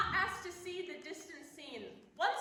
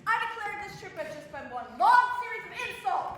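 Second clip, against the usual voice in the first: brisk indignant scold; scolding passenger; 4 semitones lower, 6 dB louder than usual. Acted. Fussy lady scolds man on train, brisk indignant. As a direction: tell them off, fast and sharp.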